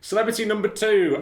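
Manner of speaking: high, loud contestant announcement; mock game-show host; announcer. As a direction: introduce the next contestant like a host.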